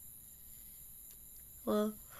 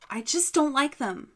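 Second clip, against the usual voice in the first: firm insistence; 3 semitones higher, 10 dB louder than usual. Acted. Girl insists to father.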